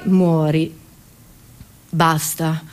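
abrupt low, sober drop; sudden deadpan. Acted. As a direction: drop out of hysteria into a low, final tone. Abrupt drop after hysterical fit. (crollo improvviso in tono basso e secco)

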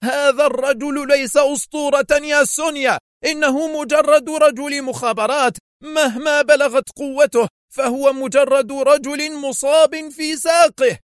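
very high, exasperated rant; high-pitched indignant outburst; furious shout. A narrator's reading. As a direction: snap back angrily, pitched high. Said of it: "صاح بها في حنق".

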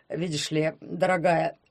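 a hint of a pompous lecturer; prim and preachy, clearing throat; stuffy lecturing husband. A narrator's reading. Poklep voiced by narrator. (чопорный зануда)

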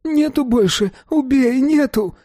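very high, pitiful pleading; whining. A narrator's reading. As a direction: whine it like a beaten wretch. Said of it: "конючил Рублёвка".

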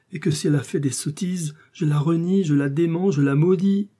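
pleading prisoner, rising in pitch; desperate, vehement disavowal; frantic Bonacieux. A narrator's reading. Bonacieux frantically disowns his wife.

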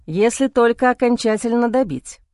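plain reading voice

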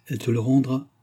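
ordinary delivery, nothing marked

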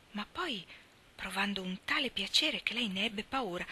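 usual speaking voice

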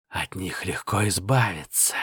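low, eerie voice in the ear; eerie whisper. Acted. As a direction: breathe it like a sinister spirit. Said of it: "раздался жуткий шёпот в ухе".

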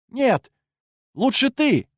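high, hesitant unsure reply; falter, unsure of yourself; uncertain voice. A narrator's reading. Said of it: "голос становился всё неувереннее".

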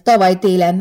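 ordinary delivery, nothing marked

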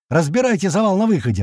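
high, urgent narrated shout; mild acted yell; yelled order. A narrator's reading. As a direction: command them with moderate volume. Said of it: "ору я".

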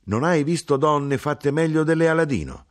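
mother scoffs at his claim; indignant mother. Acted. Mother to son, high indignant question.

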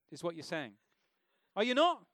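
normal talking voice

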